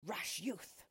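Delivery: put-on voice for an angry dwarf; lightly voiced dwarf outburst; indignant dwarf. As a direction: cry it out as an affronted dwarf. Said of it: "he cried".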